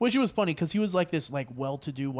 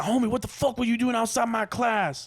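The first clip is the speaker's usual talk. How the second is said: play-acted street threat, pitched up; mock gang threat; 6 semitones higher than usual. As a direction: bark it like a hammed-up gangster. Acting the professor as gangster.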